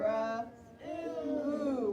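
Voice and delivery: "Ra-, ooh" as separate held sounds; sounding out. Shown as a demonstration. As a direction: draw out "ooh" after a lone R sound. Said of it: "Send out room".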